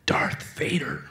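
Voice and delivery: slow, awed whisper; hushed crowd rumble. Retold or imitated. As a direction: whisper it like a rumour down a line. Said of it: "hear these rumblings going up".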